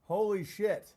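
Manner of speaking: normal talking voice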